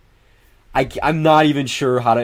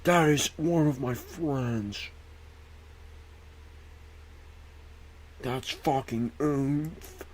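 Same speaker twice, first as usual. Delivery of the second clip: softer, slow, savouring each word; hushed delight; 3 semitones higher, 11 dB quieter, slower than usual. Real, not acted. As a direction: breathe it slowly in quiet glee. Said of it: "hushed delighted repeat".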